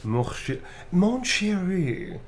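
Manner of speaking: slow, breathy, swooning "mon chéri"; romantic French lover. Acted. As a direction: melt into a seductive whisper. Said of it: "Romantic blushing type line".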